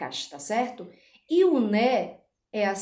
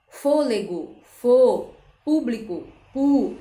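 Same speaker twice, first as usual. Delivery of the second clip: Fôlego, Público, then loud isolated fô and pu; stress on first syllable; 3 semitones higher, 6 dB louder, slower than usual. Shown as a demonstration. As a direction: punch fô and pu louder after each word. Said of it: "a sílaba tônica é a antepenúltima". (Fôlego, Público com força em fô e pu)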